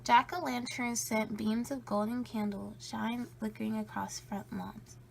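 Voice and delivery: ordinary delivery, nothing marked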